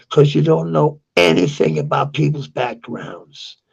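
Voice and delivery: usual speaking voice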